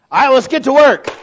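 loud, high rallying call; loud boss call to start work. Pretend. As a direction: rouse the crew to get moving. Calling the crew to work.

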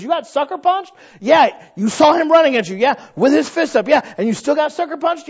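high, loud disbelieving father; father amazed at his son, loud and high; incredulous dad. Retold or imitated. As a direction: grill the kid in disbelief.

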